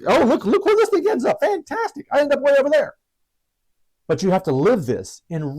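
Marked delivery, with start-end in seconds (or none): 0.1-2.9 high, gushing delight; overjoyed at the chart; excited. Retold or imitated. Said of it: "look at them at a glance and say".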